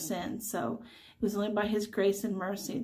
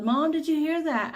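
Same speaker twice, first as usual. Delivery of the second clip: raised, higher voice calling from the next room; 6 semitones higher, 4 dB louder than usual. Retold or imitated. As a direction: call out to mom across the house. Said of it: "and he yelled".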